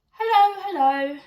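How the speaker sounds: loud, high booming bobby greeting; loud jovial policeman; policeman's hello. Acted. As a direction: call it out like a village copper. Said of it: "said Officer Pugh".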